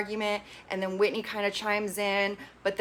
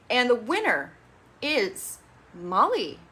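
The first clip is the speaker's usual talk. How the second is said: announcer reveal; 4 semitones higher, slower than usual. Shown as a demonstration. Award announcement.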